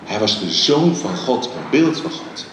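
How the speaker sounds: ordinary delivery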